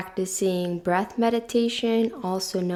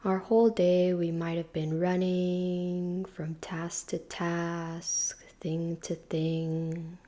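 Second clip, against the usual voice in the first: guided meditation; 3 dB quieter than usual. Real, not acted. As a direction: ease them gently into stillness, unhurried and hushed.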